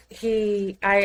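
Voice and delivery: usual speaking voice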